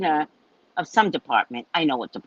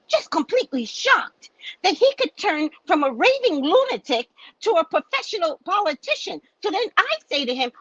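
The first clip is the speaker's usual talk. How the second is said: normal talking voice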